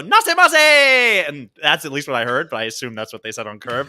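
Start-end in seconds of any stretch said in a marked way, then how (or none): 0.0-1.3 long drawn-out Japanese welcome call, high and loud; Japanese restaurant greeting. Retold or imitated. Staff calling a welcome as the door opens, drawn out and loud. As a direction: belt out the shop greeting slowly. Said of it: "they go".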